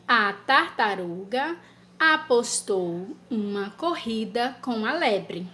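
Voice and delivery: slow, word by word dictation; dictation pace. Shown as a demonstration. Teacher dictating a sentence to children. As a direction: dictate it slowly so children can write. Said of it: "ou dois".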